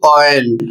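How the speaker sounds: ऑयल said as "oy-ul", gliding from O into Y; ऑयल as a classroom example word; careful English pronunciation. Shown as a demonstration. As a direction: model ऑयल with a clear OY glide. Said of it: "इसको पढ़ेंगे".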